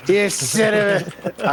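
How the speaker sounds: drawn-out playful outrage, rising high; slow, hammed-up outrage at the DM; mock outrage. Real, not acted. Player reacts to the buffed boss.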